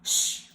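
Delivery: Shh with rounded lips, finger to lips; a long hushing Shh; shushing. Shown as a demonstration. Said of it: "telling someone to be quiet".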